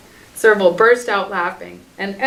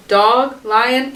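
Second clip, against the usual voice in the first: ordinary delivery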